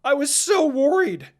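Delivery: loud, high worried yell. Acted. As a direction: burst out shouting in relief. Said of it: "he came running into me yelling".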